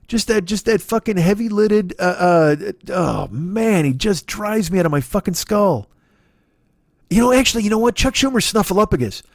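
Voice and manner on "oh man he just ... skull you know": normal talking voice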